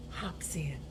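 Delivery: soft breathy whisper; hushed storybook whisper. A narrator's reading. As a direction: whisper so the bear stays asleep. Hare sneaks into sleeping bear's den.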